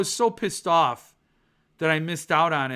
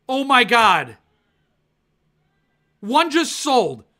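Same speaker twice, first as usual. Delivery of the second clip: dismayed outcry; 6 semitones higher, 8 dB louder than usual. Real, not acted. Sees an arc just sold.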